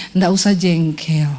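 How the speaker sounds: low, slow, gentle inner voice of God; a calm whisper in the heart saying don't be annoyed. Retold or imitated. Preacher voices God's gentle voice.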